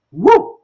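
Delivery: short, loud, high "woo"; celebratory whoop. Real, not acted. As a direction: let out a sharp whoop after the song. Cheers after finishing own song.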